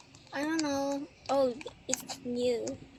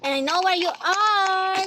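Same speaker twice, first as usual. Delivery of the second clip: teasing call-out; 6 semitones higher, 5 dB louder than usual. Pretend. Spooky seeker calling out.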